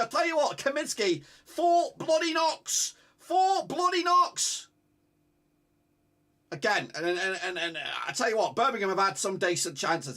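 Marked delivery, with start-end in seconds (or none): 1.4-4.7 slow, high and stressed on "four"; marvelling, each word hammered; drawn-out emphasis. Real, not acted. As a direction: stretch out "four bloody knocks" in awe. Marvelling at keeper's saves.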